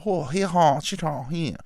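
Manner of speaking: put-on high-pitched caricature of a Japanese accent; mock Japanese character. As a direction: lightly imitate an over-the-top Japanese wrestling character. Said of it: "doing Japanese character".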